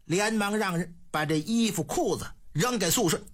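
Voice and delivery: usual speaking voice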